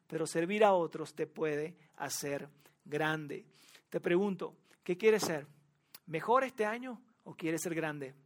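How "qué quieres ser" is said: normal talking voice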